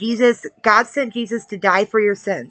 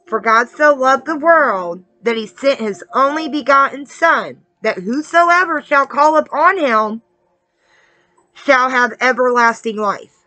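raised, emphatic preacher's recitation; scripture recitation; 4 semitones higher than usual. Real, not acted. Preacher recites John three sixteen to the newcomer, proclaiming. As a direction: proclaim the verse with measured weight.

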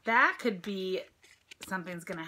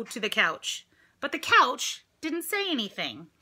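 usual speaking voice

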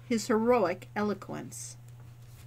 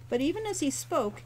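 plain reading voice

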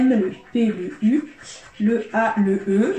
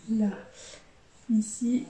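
ordinary talk, nothing marked

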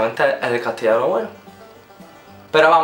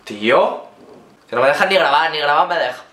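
annoyed. Real, not acted. Yelling at noisy flatmate.

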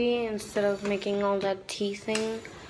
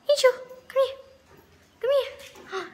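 high-pitched, louder call to a pet; calling the dog; 14 semitones higher, 4 dB louder than usual. Real, not acted. Vlogger calls her dog Angel over, high excited pet call.

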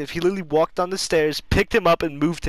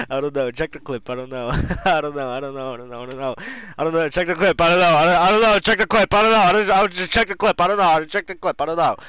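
mock parroting; 5 dB louder, much faster than usual. Teasing the friend by looping his catchphrase, mock parroting. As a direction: parrot it over and over, winding up to a frantic chant.

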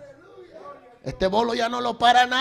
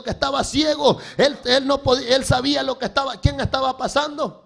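raised, insistent sermon voice; full-force preaching; 5 semitones higher, 4 dB louder than usual. Acted. Preacher contrasting blind beggar and king, forceful preaching. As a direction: drive it home to the congregation.

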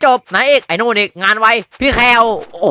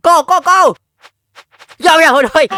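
high, loud whoops; excited cheering; 9 semitones higher, 6 dB louder, slower than usual. Real, not acted. Streamer chases a deer in the hunting game, with loud high whoops.

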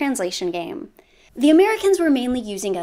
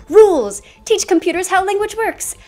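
loud, insistent and much higher; emphatic shout; 9 semitones higher, 6 dB louder than usual. Retold or imitated. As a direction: bark the rule like a zealot. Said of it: "The perfectionists who were like".

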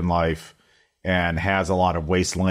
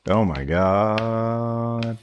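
song intro voice; much slower than usual. Retold or imitated. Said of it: "I like big butts".

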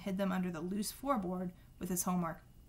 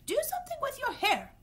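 shrill high snap, a bit louder; much higher, harsh aunt's snap; Aunt Petunia snapping; 4 dB louder than usual. A narrator's reading. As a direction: bark it at him in a high shrill voice. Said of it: "Aunt Petunia snapped".

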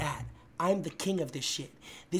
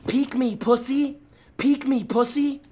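building taunt; 6 semitones higher, 6 dB louder than usual. Real, not acted. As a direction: egg the enemy on, building up. Taunting an enemy in game.

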